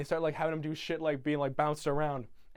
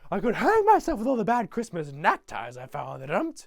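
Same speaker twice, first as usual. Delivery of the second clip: louder, higher theatrical outburst; mock angry rant; 4 semitones higher, 6 dB louder than usual. Grinch ranting about gifts, loud mock outrage. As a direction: rant it loudly in a put-on fit.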